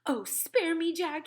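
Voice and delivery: high, loud plea for mercy; pleading yell. A narrator's reading. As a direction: beg loudly in terror. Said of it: "he yelled".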